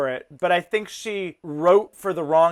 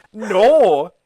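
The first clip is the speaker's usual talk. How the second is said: ordinary delivery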